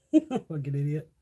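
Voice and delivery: ordinary delivery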